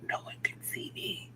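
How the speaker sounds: soft, tiny whisper; hushed, secretive little whisper; hiding whisper. Acted. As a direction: whisper it as if hidden away. Imagined hiding in a hole.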